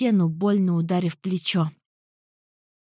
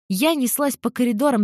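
ordinary delivery

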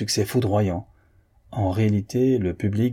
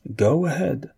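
higher, cheerful send-off; 7 semitones higher, slower than usual. A narrator's reading. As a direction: add it gaily, pitched up. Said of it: "ajouta gaiement l'Américain". (gaiement, d'une voix plus haute)

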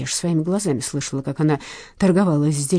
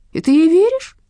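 doubting, drawn-out, higher and louder; doubtful drawl; 13 semitones higher, 7 dB louder than usual. A narrator's reading. Uncle Vasya doubting Lena's story, skeptical drawl. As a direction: voice your disbelief. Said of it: "с сомнением протянул".

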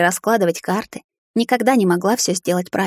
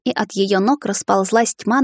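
ordinary delivery

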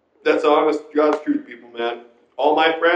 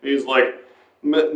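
ordinary talk

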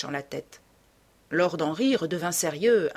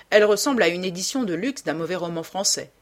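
ordinary delivery, nothing marked

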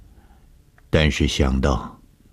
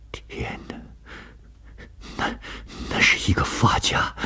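whispered, halting shock; frightened gasping; 6 semitones higher, 7 dB quieter than usual. A narrator's reading. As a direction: breathe it out in horror, stumbling on the words. Frightened discovery in the dark cave. (惊恐低语，断断续续)

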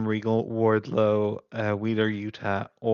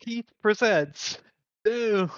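announcer voice; 10 semitones higher than usual. Retold or imitated. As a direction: build up to Doom like a hype man. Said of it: "You have it".

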